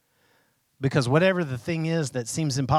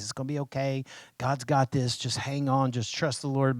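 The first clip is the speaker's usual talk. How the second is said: softer, gentle comfort; reassuring friend; 2 semitones lower, 7 dB quieter than usual. Retold or imitated. Preacher voicing a believer softly reassuring a struggling friend. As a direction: soothe someone who is scared. Reassuring someone in a hard situation.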